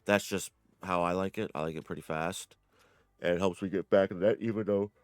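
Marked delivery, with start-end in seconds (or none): 3.2-4.9 talking through a yawn. Real, not acted. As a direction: yawn mid-sentence. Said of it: "sorry about that".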